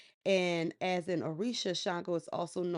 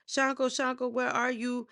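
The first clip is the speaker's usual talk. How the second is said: calling out; 6 semitones higher than usual. Retold or imitated. Search party calling in jungle.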